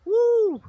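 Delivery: loud high whoop of real delight; excited whoop. Real, not acted. Beekeeper cheering at the arriving swarm, loud high whoop. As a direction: let out a big joyful woo.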